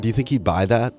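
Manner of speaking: ordinary delivery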